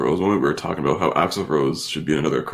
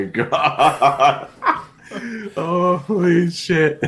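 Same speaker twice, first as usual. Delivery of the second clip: slow, laughing, higher; 11 semitones higher, much slower than usual. Real, not acted. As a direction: laugh through it. Laughing at the memory.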